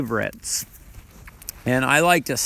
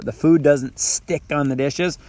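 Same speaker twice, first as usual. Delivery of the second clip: ordinary talk, nothing marked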